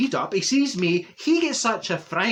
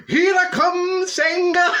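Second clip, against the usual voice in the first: loud high battle cry as she charges; bull's charge yell; 14 semitones higher, 7 dB louder, slower than usual. Acted. [name] charging Senga as the bull, loud high yell.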